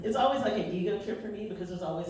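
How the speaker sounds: ordinary talk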